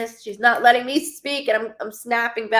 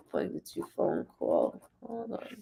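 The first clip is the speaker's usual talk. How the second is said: unvoiced hushed aside; whispering; 9 dB quieter than usual. Real, not acted. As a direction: whisper it to yourself. Hushed aside mid-reading.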